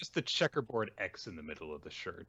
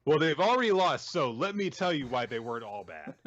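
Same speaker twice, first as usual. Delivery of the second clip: mock manga author; 6 semitones higher, 7 dB louder than usual. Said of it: "It's like".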